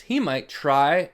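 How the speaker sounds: plain reading voice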